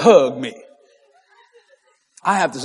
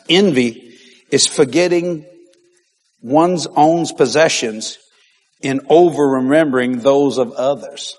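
slow, deliberate dictation pace; 4 semitones lower, slower than usual. Shown as a demonstration. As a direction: spell it out slowly so they can write. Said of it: "write this down".

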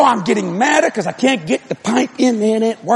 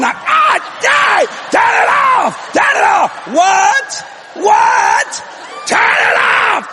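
frantic high-pitched yelling; scalded screaming; 10 semitones higher, slower than usual. Retold or imitated. Husband being scalded yells up to wife, frantic screaming. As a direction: scream for the water to stop.

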